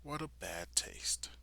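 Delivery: breathy whisper of a cold remark; whispered line. Retold or imitated. Villain sneering at the fallen girl, whispered. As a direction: whisper it with disdain. Quote read at start.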